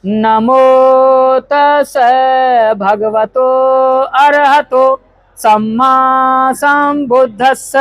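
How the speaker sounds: measured, syllable-by-syllable recitation; Pali recitation. Real, not acted. Leader reciting Pali lines for guests to repeat, measured and slow. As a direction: recite slowly so the crowd can follow.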